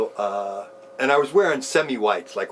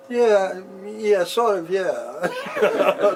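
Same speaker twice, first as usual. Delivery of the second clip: sheepish laughing yes; 8 semitones higher, much slower than usual. Retold or imitated. Sheepish answer to guru, laughing.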